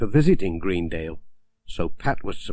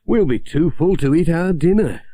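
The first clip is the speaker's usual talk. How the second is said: higher, louder jolly postman; Pat joking; 7 semitones higher, 5 dB louder than usual. Acted. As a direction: joke about too many mince pies. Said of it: "said Pat".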